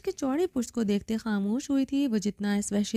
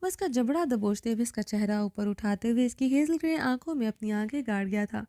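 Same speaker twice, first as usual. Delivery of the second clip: ordinary delivery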